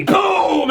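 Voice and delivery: vocal sound effect of a breath attack; drawn-out cartoon boom; imitated explosion. Imitated blast of dragon breath.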